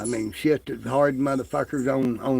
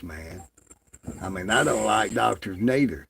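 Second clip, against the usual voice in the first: usual speaking voice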